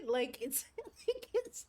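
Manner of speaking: words broken up by laughter; real laughing. Real, not acted. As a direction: start the sentence and crack up. Teller laughing while commenting on story.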